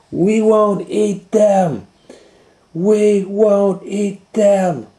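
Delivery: high, loud, slow chant; children shouting. Acted. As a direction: shout it as a class. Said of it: "shouted the children".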